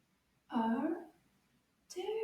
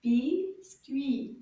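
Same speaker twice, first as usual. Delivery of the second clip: Biscuit split as BIS, pause, CUIT; slow two-piece Biscuit; syllable by syllable; 4 dB louder than usual. Shown as a demonstration. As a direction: separate both E sounds in Biscuit. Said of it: "there's two E E E sounds".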